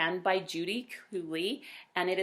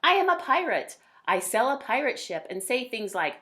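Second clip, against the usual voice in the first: lively, louder storybook voice; reading to children; 2 semitones higher, 4 dB louder than usual. A narrator's reading. A parent reading a picture book aloud to kids, lively. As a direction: read it brightly to young listeners. Reads child narrator Max.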